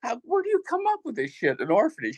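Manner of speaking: squeaky high laughing question; incredulous and cracking up; half-laughing high-pitched disbelief. Retold or imitated. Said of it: "I said".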